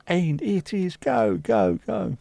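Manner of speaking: high put-on voice, cheering go go go; mock cheer. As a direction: chant it like a giddy mate. Mimicking someone at the hotel.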